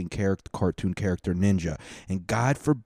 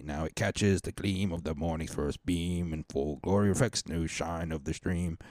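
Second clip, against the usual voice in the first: poem recital; 4 semitones lower, 9 dB quieter than usual. Acted. Reading anthem lyrics aloud.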